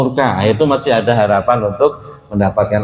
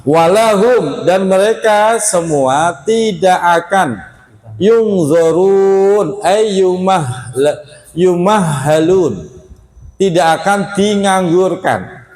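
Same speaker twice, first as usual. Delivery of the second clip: slow, higher, drawn-out recitation; verse recitation; 6 semitones higher, much slower than usual. Acted. Preacher reciting verse meaning to congregation, slow and higher. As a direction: draw out each word like tilawah.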